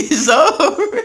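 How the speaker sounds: high, laughing resignation; laughing it's-over. Real, not acted. Laughing at his own fate.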